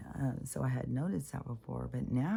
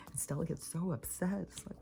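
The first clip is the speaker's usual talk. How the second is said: ordinary talk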